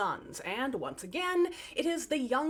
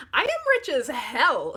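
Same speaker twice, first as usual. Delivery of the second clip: bratty boast; 3 semitones higher, 7 dB louder, slower than usual. Acted. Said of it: "she said".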